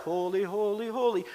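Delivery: softly intoned choir imitation; soft mock-angelic chant; heavenly choir. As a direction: lightly mimic a choir, soft and airy. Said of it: "join in with the heavenly choir".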